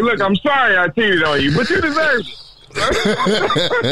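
insincere apology that blames her; mock-sorry, laughing through it; tone contradicting words. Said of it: "That was like".

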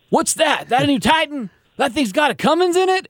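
high, drawling Southern trucker voice; mock Southern accent. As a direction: play a wowed Southern truck-stop guy. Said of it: "why are we all doing the Southern accents".

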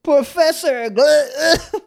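high, slow drawn-out name; silly name. Pretend. High slow drawn name joking with a friend. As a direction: drag out the fake name up high.

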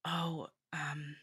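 slow, soft, reluctant delivery; hushed, apologetic. Acted. Someone apologetically telling her the test is positive, hushed. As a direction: break it very quietly, almost whispering. Reads out someone breaking unwelcome pregnancy news.

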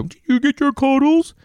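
put-on high, sing-song kids' TV host voice; children's show voice. Parent asking daughter about cuddles, high sing-song. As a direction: coo it sweetly like a kids' presenter. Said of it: "you talk like, like you ha-, have a children's show".

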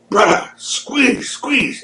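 high, drawn-out squeaky urging; urgent mock plea. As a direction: imitate a strained covert plea. Retold wrestler urging during secret blading.

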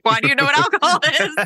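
high, fast shocked question. Retold or imitated. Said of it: "I shamed myself".

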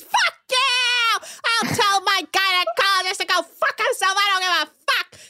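high-pitched, worked-up tirade; hammed-up indignant outburst; mock hysterical rant. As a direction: rant high in an exaggerated imitation. Acted rant about having babies.

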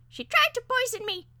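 high, loud squeaky protest; falsetto, accusing bird voice; arguing parakeet. A narrator's reading. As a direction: plead your case as an aggrieved bird. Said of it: "the parakeet argued on his own behalf".

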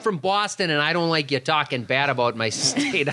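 badly faked Boston tough talk; botched Boston accent. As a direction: put on a Boston accent and lose it. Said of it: "I don't know what accent I'm doing anymore".

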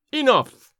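high, loud, affronted outburst; sudden cry. A narrator's reading. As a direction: cry out to cut the music short. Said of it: "he cried".

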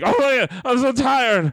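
high-pitched, overdone mock complaint; dramatic exhausted whining. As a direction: imitate a worn-out friend, hammed up and high. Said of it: "He's so dramatic with it".

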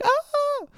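very high imitation squeal; mock starstruck shriek; excited fan squeal. Imitates fans recognizing him.

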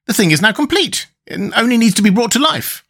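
high-pitched admiration; admiring. A narrator's reading. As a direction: admire the finished work, pitch well up. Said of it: "with considerable admiration".

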